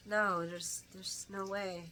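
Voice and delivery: soft, halting retold denial; hushed mock disbelief; imitating stunned disbelief. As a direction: say it quietly, as if stuck. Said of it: "he's just stuck".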